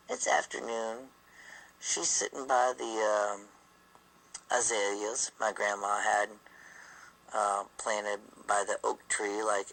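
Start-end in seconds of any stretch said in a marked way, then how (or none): none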